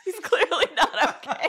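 high, laughing through the words; real giggling disbelief. Real, not acted. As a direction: crack up while you say it. Laughing at the movie scene.

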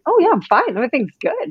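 high, bright fake-fine reply; breezy pretend everything's-fine; fake cheerful. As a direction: brush it off with a forced chirpy smile.

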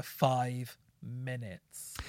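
very slow, stretched-out words; drawn-out disbelief. Real, not acted. Stressing the tedious length to a co-host, drawn out.

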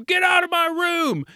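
high-pitched, hammed-up sibling yell; mock angry yell. Yelling at little brother who comes in the room, mock outrage. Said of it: "instead of yelling at him".

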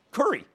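"curry" as the speaker's accent says Kerry; own accent pronunciation. Shown as a demonstration. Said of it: "the way I pronounce curry".

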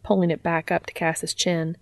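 ordinary delivery

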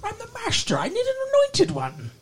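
very high, hammed-up cartoon villain; vampire master. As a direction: squeal it like a cartoon evil overlord. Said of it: "Yes, Skeletor".

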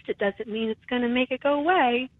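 ordinary talk, nothing marked

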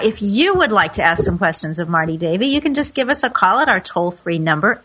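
ordinary talk, nothing marked

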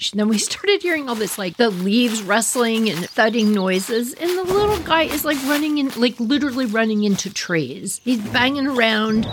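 ordinary talk, nothing marked

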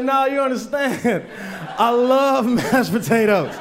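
high, slow, drawn-out adoration; blissed-out gushing. Retold or imitated. Man on Molly to a dance partner, blissed-out gushing. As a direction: stretch it out in dreamy acted rapture.

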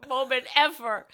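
high, loud, through real laughter; laughing hard. Real, not acted. Recalling a disgusting film scene to a friend, laughing hard. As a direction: crack up while protesting, pitch shooting up.

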